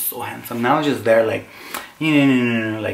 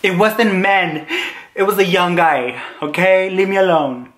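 higher, whiny mock protest; indignant retort; 6 semitones higher, 3 dB louder than usual. To his sister warning about men, indignant high protest. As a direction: protest it high, like a huffy teen.

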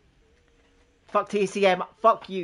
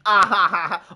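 hearty loud laughter; real laughter at the joke; 3 semitones higher, 9 dB louder than usual. Real, not acted. Laughing loudly.

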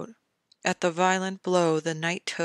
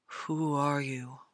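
inner thought; 3 semitones lower, 5 dB quieter, slower than usual. A narrator's reading. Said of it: "Ned thought".

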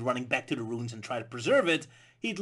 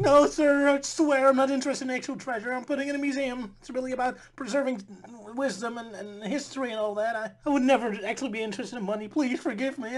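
much higher, whiny begging; pleading Indy; 8 semitones higher, 3 dB louder than usual. Acted. Indy begging the audience, hammed-up pleading. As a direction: grovel for forgiveness. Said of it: "And Indy's all pleading".

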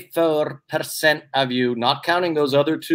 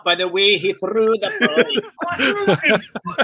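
laughing joke; 7 semitones higher, slower than usual. Real, not acted. Host joking about Billy's throw, laughing high. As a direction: crack up as the joke lands.

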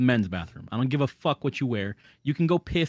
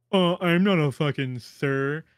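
slow, mock-indignant retort; imitating an offended stranger; 3 dB louder, slower than usual. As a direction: act out someone taking offence, drawn out. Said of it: "if you really get offended".

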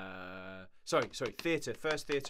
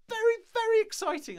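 much higher and louder, bursting with glee; gushing excitement; 14 dB louder than usual. Real, not acted. Streamer recalling her first paid game role, squealing excitedly. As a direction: squeal it out with delight.

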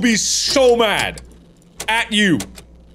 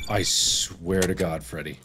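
muttered curses; 13 semitones lower, 13 dB quieter, faster than usual. Real, not acted. Muttering quietly at Freddy.

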